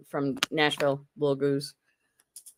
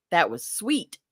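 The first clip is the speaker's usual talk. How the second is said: normal talking voice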